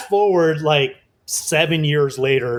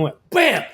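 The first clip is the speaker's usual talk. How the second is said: mock crash; 9 semitones higher than usual. Print hits the table edge.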